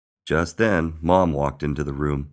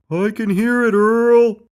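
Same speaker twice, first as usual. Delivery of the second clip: very loud, high answering cry; high full-voiced call, loudest on the name; 11 dB louder, slower than usual. A narrator's reading. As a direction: yell back over the storm. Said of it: "cried Buddy".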